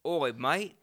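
mock tough British-Australian; British turning Australian accent. Host acts a brawler challenging a mate, British drifting into Australian accent. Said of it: "more of an Australian accent now".

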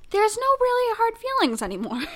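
high, unconvincing pretence of being fine; badly faked sincerity, about to crack up; feigned no hard feelings. Woman claiming to be over her cheating ex, badly faked sincerity. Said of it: "can't even say that with a straight face".

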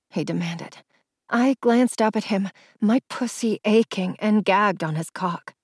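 plain reading voice

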